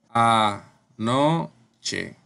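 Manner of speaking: "Anoche" in separated syllables, "no" lifted; spaced-out "Anoche", weight on "no"; stress on NO. Shown as a demonstration. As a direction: sound out "Anoche" with pauses, stressing the middle "no". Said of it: "La sílaba tónica es no".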